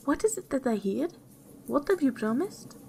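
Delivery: high-pitched, lightly distressed questioning; weeping queen. A narrator's reading. Weeping queen questions the princess about her promise, lightly tearful.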